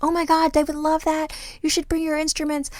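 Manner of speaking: high, gushing mock enthusiasm; a hammed-up rave from the friends. Voicing enthusiastic Israeli friends.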